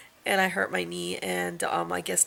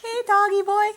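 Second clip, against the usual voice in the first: much higher cooing pet talk; 13 semitones higher, 5 dB louder than usual. Real, not acted. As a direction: coo up high at the dog. Cooing at the dog.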